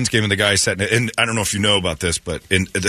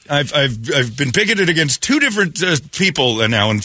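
ordinary delivery

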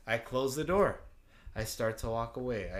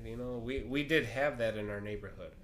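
usual speaking voice

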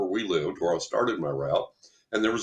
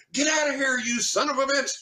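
shouted insult. Retold or imitated. Friend throwing a rock, yelling at the unseen creature. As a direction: hurl it into the dark alley. Friend yells at howling thing.

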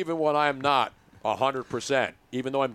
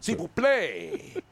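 put-on French accent; 2 semitones higher, much slower than usual. Teasing French-Canadian guest.

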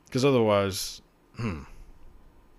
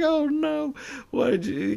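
laughing despair. Retold or imitated. Mock dismay at Peter Pan scene.

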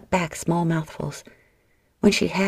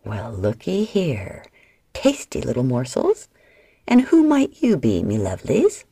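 leering slaver; 5 semitones lower than usual. A narrator's reading. Slaver gloating over the trapped sisters, leering. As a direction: savour each word like a greedy villain. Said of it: "He grinned through yellow teeth".

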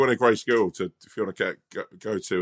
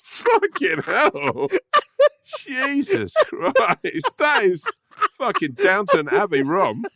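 high, slow words squeezed through laughter; real laughing fit, much higher and drawn out; helpless laughter; 13 semitones higher, 4 dB louder, much slower than usual. Real, not acted. Helpless laughing at friend's pronunciation.

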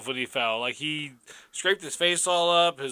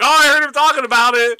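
mock witness; 8 semitones higher, 9 dB louder than usual.

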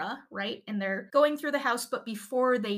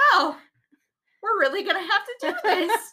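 much higher and louder, laughing; 8 semitones higher, 6 dB louder than usual. Retold or imitated. Officer dreading the search, high laughing voice. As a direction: imitate the nervous cop with a raised voice. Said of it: "his voice went higher".

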